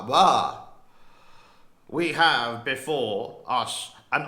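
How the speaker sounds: slow, booming British Churchill voice with gruff bahs; Winston Churchill accent. Retold or imitated. Vlogger doing Churchill's wartime speech, slow booming British accent. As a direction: boom it out like Churchill addressing the House. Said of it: "I got to put in my Winston Churchill accent".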